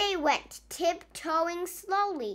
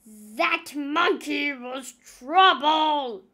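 slow, louder character voice; Pirate Nong's voice; 7 dB louder, much slower than usual. A narrator's reading. Nong remarking after the monkey ran off, slow. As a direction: draw it out wearily in Nong's voice. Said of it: "said Pirate Nong".

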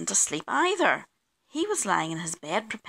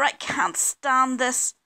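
higher, louder, stretched complaint; exasperated bear; 5 semitones higher, 4 dB louder, slower than usual. A narrator's reading. Mister Bear disturbed by kitchen noises, exasperated. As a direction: draw it out, fed up.

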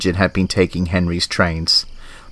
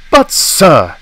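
higher, louder protest; crying out; 7 semitones higher, 5 dB louder than usual. Acted. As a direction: blurt out the objection. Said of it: "Gordon cried".

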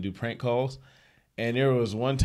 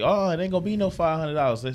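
higher, indignant refusal; indignant woman; 7 semitones higher than usual. Retold or imitated. Woman refusing fake City Hall fee, high indignant. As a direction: push back high and offended. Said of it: "And she like".

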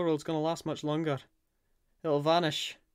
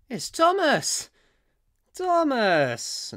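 surprised; 7 semitones higher, 4 dB louder, much slower than usual. Acted. Sees Thomas arrive.

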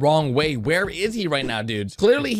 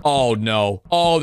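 normal talking voice

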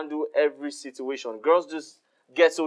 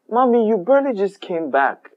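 louder, higher protest; surprised protest; 9 semitones higher, 6 dB louder than usual. Acted. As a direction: push back, worried. Son protests mother going to work.

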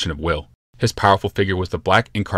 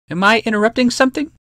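much higher, teasing woman's voice; a playful interruption with a smile; sly Dyfed; 4 dB louder than usual. Acted. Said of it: "a smile quirking her lips".

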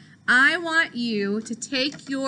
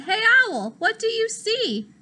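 lightly voiced cat calling, higher; calling up to owl; 9 semitones higher, 3 dB louder than usual. Pete the cat calling up to the owl, higher. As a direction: call up into the tree, a bit higher.

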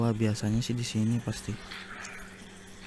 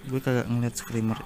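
ordinary delivery

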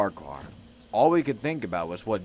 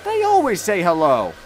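high, loud, wounded protest; hurt; 11 semitones higher, 7 dB louder than usual. A narrator's reading. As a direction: cry out in hurt bewilderment. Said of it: "Thomas was hurt".

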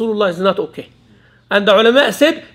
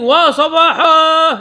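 mega voice shout; 5 semitones higher, much slower than usual. Retold or imitated. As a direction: stretch it out like a call across a battlefield. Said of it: "his voice was mega voice".

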